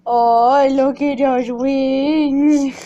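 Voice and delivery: loud, slow delighted praise; delighted gasp. Real, not acted. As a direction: marvel loudly at the wings. Delighted at the wings.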